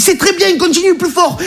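very high-pitched coaching cry; acted high-pitched exhortation; urgent encouragement. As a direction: egg them on frantically. Voice urging on the massage.

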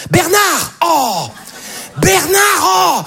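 put-on high calling yell after a kid; mother yelling. Mother chasing her four-year-old in the square, high yell. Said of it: "qui est là genre".

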